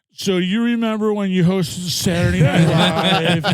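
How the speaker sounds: awestruck fan voice, high and drawn out; Chris Farley. Retold or imitated. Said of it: "then I turned into Chris Farley".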